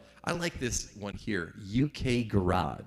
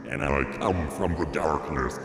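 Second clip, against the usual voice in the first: spooky modulated announcer; ring modulator voice; 7 semitones lower, 3 dB louder than usual. Shown as a demonstration. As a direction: lower metallic ring mod voice. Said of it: "ring modulator".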